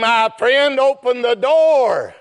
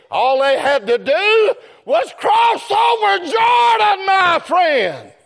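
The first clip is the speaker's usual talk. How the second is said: preaching at full force; 11 semitones higher than usual. Acted. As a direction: ring it out like a revival preacher. Preacher peaks on Jordan.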